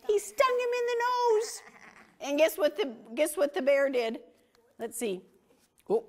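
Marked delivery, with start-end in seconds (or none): 0.1-1.6 dramatic storytelling. A narrator's reading.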